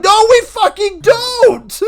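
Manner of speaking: high, loud, slow outraged yell; group shout. Retold or imitated. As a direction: shout it as the whole room. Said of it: "And the rest of the lunchroom's like".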